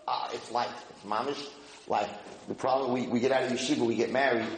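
ordinary talk, nothing marked

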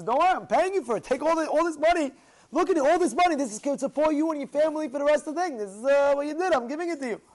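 loud, high, rushed sales pitch; eager persuading. Acted. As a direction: push the money on him insistently. Rich man pressing money on him.

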